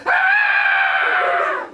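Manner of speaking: very high loud scream. Retold or imitated. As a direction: shriek at the sight of the hurt brother. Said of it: "she goes".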